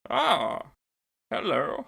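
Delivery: high, loud villain greeting; Count Vidian voice. Acted. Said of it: "Enter Count Donatus Vidian".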